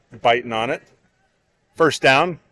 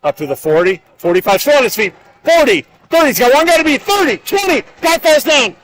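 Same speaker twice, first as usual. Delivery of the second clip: high, loud touchdown call building to a shout; excited play-by-play; 13 semitones higher, 7 dB louder than usual. Real, not acted. Announcer calls a long touchdown run, excited shouting play-by-play. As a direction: call the breakaway run, rising to a full shout.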